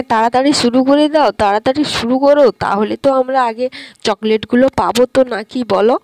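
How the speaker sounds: ordinary talk, nothing marked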